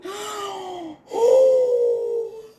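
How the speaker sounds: big drawn-out horrified gasp; long high gasp. Acted. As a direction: gasp loudly in shock. Zebra discovers loss.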